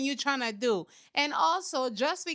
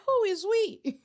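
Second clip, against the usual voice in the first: higher, mock-French "Who is we?" with "we" like oui; 7 semitones higher, slower than usual. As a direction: pitch "Who is we?" up as a French joke. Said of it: "Do they speak French down there".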